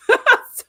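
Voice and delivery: short high loud laugh; burst of laughter. Real, not acted. Reacting to Dukat becoming a Pah-wraith, laughing out loud.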